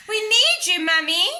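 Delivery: high, loud cry for help; shouting. A narrator's reading. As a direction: yell for Mummy to save the castle. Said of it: "shouted Peppa".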